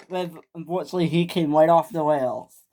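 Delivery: ordinary delivery, nothing marked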